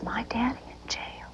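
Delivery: hushed whisper, barely voiced; whispered child's words. A daughter whispering to her mother about jail. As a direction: breathe it out like a frightened small girl.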